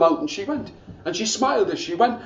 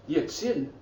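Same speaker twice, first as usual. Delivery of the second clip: plain reading voice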